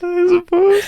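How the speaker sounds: squeaky high movie voice; high mock voice. Mimicking a film scene line.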